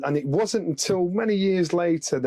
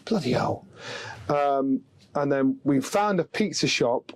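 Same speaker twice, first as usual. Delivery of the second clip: usual speaking voice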